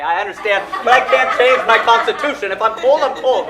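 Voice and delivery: loud, high, laughing protest; exasperated laughing outburst; laughing rant. Real, not acted. Laughing rant about being cold.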